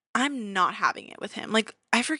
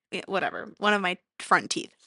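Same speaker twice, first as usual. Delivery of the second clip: ordinary delivery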